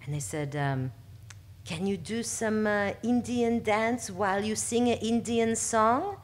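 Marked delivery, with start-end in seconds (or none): 1.7-6.2 a higher, slower, hammed-up French accent; a slow, hammy French director making the request; terrible French accent. A casting director asking for an Indian dance, in a mock French accent. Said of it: "But I'm gonna keep going with it".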